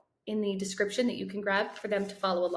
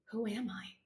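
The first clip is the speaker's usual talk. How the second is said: much softer, hollow thought; stunned inner whisper; 9 dB quieter than usual. Acted. Boy reads his own name and wonders, far softer. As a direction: let it drop, barely aloud. Inner question after reading chart.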